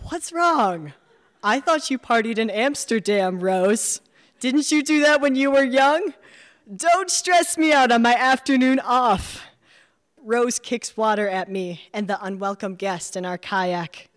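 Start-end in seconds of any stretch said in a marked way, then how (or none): 0.1-9.4 higher, louder playful needling; teasing. Acted. Narrator teasing Rose in the kayak, playful needling. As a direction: tease her about Amsterdam.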